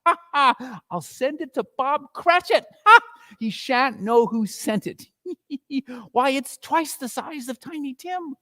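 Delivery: high, gleeful chuckle through words; old man cackling with delight; chuckling Scrooge. A narrator's reading. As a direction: laugh at his own joke. Scrooge chuckling at his plan.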